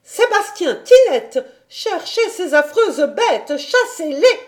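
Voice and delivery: high, loud panicked orders; anguished cry. A narrator's reading. Governess calls servants to chase kittens, anguished cry. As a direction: shriek for help, high and frantic. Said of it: "le cri d'angoisse".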